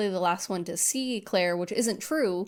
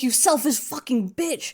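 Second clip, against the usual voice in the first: mock angry Peter; 5 semitones higher, 3 dB louder than usual.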